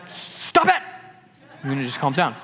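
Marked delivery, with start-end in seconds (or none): 0.1-0.8 high-pitched, strained cry of command; hammed-up, fed-up outburst; exasperated rebuke. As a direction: snap at the storm, voice leaping up. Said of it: "he rebukes the storm".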